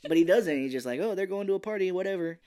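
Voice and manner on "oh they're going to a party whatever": higher-pitched, airy brush-off; mock indifference. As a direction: shrug it off in a put-on voice. Imitating Billy's indifferent reaction.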